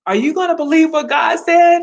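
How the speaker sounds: rising preacher's challenge; preaching. Real, not acted. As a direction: push the question up like a preacher.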